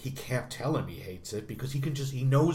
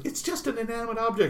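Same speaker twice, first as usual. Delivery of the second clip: higher, quicker voice for the wife; the wife's line pitched up and brisk; mock wife line; 9 semitones higher, faster than usual. Wife calmly telling Harry off, higher and quicker. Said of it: "she goes".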